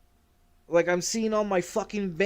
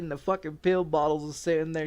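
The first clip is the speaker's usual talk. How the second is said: usual speaking voice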